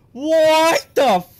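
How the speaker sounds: loud, high, stretched-out shock; shocked outburst. Real, not acted. Player startled by the game, shocked outburst. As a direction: cry out in disbelief, cut off mid-curse. Sudden shock during play.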